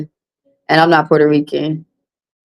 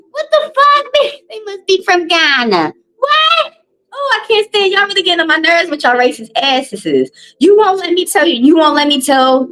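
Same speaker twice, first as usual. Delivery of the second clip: exasperated rant; 11 semitones higher than usual. Real, not acted. Heated rant at racists.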